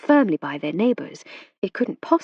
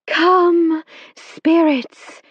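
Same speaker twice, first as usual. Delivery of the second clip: higher, louder, otherworldly call; faraway voice; 10 semitones higher, 7 dB louder, much slower than usual. A narrator's reading. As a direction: summon the spirits from far away. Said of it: "in her faraway voice".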